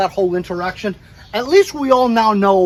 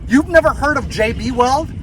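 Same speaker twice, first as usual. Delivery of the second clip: higher, incredulous rising question; mock disbelief; 6 semitones higher than usual. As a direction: act out disbelief at the clerk. Retold disbelief at the clerk.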